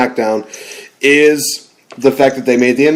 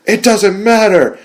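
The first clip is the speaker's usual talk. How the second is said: lightly voiced Rock impression, pitched higher; The Rock catchphrase; 8 semitones higher than usual. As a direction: toss it off like The Rock dismissing a question. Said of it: "a gif of The Rock just saying".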